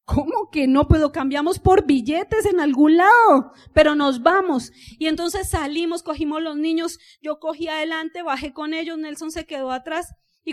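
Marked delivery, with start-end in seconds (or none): 0.1-4.7 loud, slow, insisting she won't back down; insistent shout. Retold or imitated. Wife insisting to husband they leave, loud and insistent. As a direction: push through his refusal loudly.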